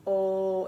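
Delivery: "O" held as a long rounded oh; long O sound. Shown as a demonstration. Teacher showing the mouth shape for the long O, demonstrating. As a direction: round the lips and hold "O". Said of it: "Even when you do the long O sound".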